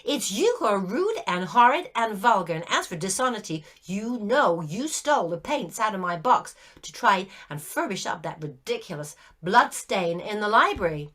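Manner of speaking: put-on loud, heated girl's rebuke; indignant scolding. A narrator's reading. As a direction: tell him off, offended and cross. Virginia scolding the ghost.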